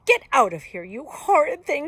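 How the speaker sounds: loud, indignant, rising in pitch; furious cry. A narrator's reading. Granny interrupted at her reading, furious cry. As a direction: shout the intruder out. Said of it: "she cried".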